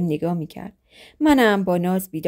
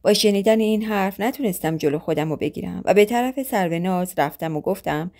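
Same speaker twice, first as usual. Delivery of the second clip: ordinary delivery, nothing marked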